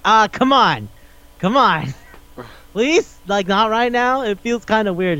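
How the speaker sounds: high-pitched mock pleading; pleading protest. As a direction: act out an uncomfortable plea to stop. Acted protest at back being licked.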